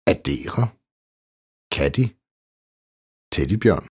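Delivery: addere, katty, teddybjørn with a hard d; hard stopped D in the middle of "addere", "katty", "teddybjørn". Shown as a demonstration. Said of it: "også når det står som dobbeltkonsonant". (hårdt D i addere, katty og teddybjørn)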